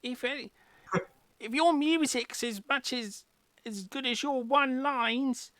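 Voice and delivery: high, loud mocking Cockney; Cockney barmaid mocking the bard's lines. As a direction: tease him in Cockney. Barmaid mocks the bard.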